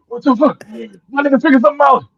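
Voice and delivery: high, loud panicked cry; frantic, fighting for air; panicked gasp. Retold or imitated.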